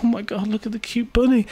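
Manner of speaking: put-on high squeal of a girl melting over cuteness; gushing girl impression. Podcaster mimicking a girl who adores a bunny, high gushing voice. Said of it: "she's crying because the bunny's so cute".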